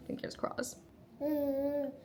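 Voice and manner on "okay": high soft coaxing voice. Real, not acted. Feeding baby first egg.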